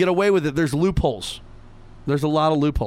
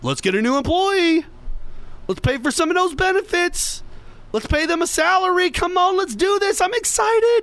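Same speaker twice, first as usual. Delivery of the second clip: building from plain to much higher, hammed-up glee; mock eager boss; 12 semitones higher than usual. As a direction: pump the team up, getting giddier. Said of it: "Nobody turns around and goes".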